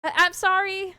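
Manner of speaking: calling out. A narrator's reading. Player calls after the puppy's owner, calling out apologetically. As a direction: call a sorry after someone leaving. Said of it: "you call out".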